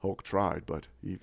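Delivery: low, soft acted regret; Thor. Thor answering about Hulk, low subdued imitation. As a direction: imitate a downcast hero, halting. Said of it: "And he goes".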